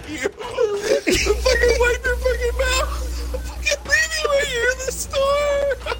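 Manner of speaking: real tears of laughter, barely getting the words out; crying with laughter. Real, not acted. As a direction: shout it while laughing so hard you cry. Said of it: "I'm fucking crying".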